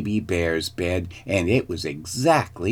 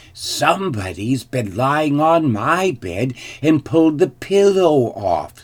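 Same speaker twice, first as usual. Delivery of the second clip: mother bear voice, pitched higher; lightly put-on, higher bear complaint; middle-sized mother bear; 6 semitones higher, 4 dB louder than usual. A narrator's reading. Mother bear finds her bed disturbed, higher voice. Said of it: "said she in her middle-sized voice".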